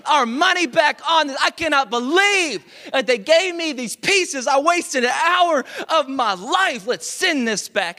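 hammed-up fury at the company; mock outrage. Dad ranting about the faulty pieces, hammed-up outrage. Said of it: "I'm like".

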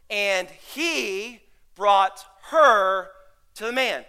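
very slow, word-by-word Bible reading. Shown as a demonstration. Pastor showing church how to read the verse, slowly. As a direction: draw out HE, BROUGHT and HER, each set apart. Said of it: "Read it slowly".

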